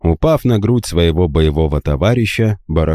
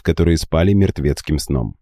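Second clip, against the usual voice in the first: usual speaking voice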